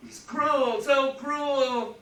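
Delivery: high, loud mock wail; mock lament. Mocking complaint, then calls complainer weakling.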